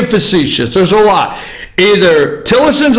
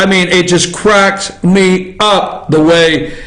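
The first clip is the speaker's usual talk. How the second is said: normal talking voice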